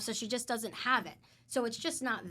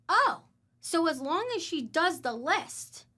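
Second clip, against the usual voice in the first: surprised; 7 semitones higher, 7 dB louder than usual. Retold or imitated. Realizing caseworker's meaning.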